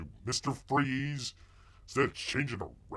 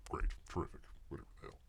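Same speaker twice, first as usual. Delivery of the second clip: whispered aside; 6 semitones lower, 14 dB quieter, much faster than usual. Real, not acted. Muttering to himself at the screen.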